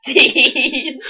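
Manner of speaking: high, loud, laughing; high loud laughing seized; laughing correction. Real, not acted. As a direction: correct the word while cracking up.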